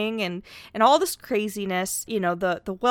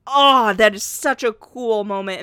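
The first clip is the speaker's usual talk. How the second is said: louder, a burst of real delight; real excitement; 6 dB louder than usual. Real, not acted. Host gushing to co-host about the BioShock twist, excited. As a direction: gush about the twist like a fan.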